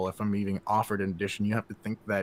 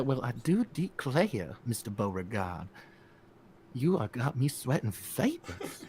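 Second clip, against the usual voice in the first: slow, lightly put-on Southern belle accent; a hint of a swooning Southern belle accent, slowly. Answering a fan question with a slow Southern belle accent. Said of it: "uh, Southern Bear".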